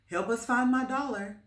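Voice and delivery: put-on high, loud eager boy; boy asks his dog to sniff out the dollar, high; pleading with dog. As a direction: coax the dog to help.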